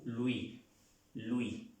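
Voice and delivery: "Louis" as "lu-í", OU as u, silent S; OU suena U. Shown as a demonstration. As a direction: say "Louis" with an u sound and no final S. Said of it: "suena U". ("Louis" como "luí", con OU como U y sin S)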